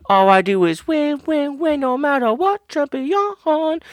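high, slowed chant of winning; boastful. Acted. Host bragging about his title to the co-host, high mock chant. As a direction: crow about always winning. Boasting self-introduction, sung-style.

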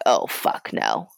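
low, slow fuming oh fuck no; a mock fuming growl.

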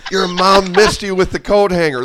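high taunting drill sergeant; mock the recruit like a drill sergeant. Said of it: "They're like".

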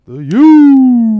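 loud hype shout of the U. Real, not acted. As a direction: yell it like a fan chant. Hyped callout of Miami school.

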